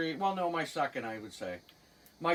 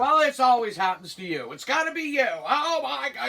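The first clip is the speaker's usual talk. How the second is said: mock angry outburst; 7 semitones higher, 7 dB louder than usual. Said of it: "you just get angry".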